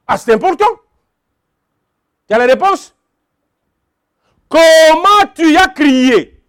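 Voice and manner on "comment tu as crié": slow, weighty question, Comment leaned on; each word laid down slowly; emphatic question. Acted. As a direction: drive the question home to the congregation. Preacher hammers key question.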